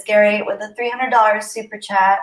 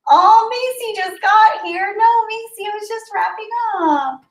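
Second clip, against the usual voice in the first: playful high wail; 14 semitones higher, 4 dB louder than usual. Real, not acted. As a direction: wail it high to a friend who came too late. Late friend arrives as stream ends.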